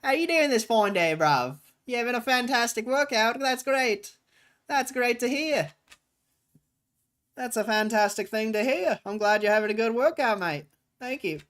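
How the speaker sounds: high, loud mock London lad; British accent. Greeting someone at the gym, put-on British accent. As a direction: cheer them on in a hammed-up British accent. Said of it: "I'm not actually British".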